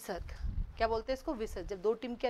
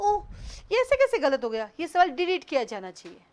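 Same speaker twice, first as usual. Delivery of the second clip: louder, higher mock outcry; imitating protesting students; 4 semitones higher, 6 dB louder than usual. Teacher mimicking students after a wrong exam answer, mock protest. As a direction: act out a student's indignant complaint. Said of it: "फिर आप कहते हो".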